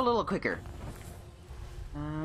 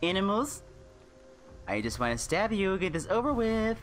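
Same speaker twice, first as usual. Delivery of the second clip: usual speaking voice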